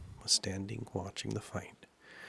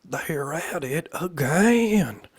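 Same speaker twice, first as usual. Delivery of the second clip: high, drawn-out, very loud yell; top-of-voice shout; 8 semitones higher, 14 dB louder, much slower than usual. A narrator's reading. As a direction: shout it at full voice instead of whispering. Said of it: "simply shouted at the top of the voice".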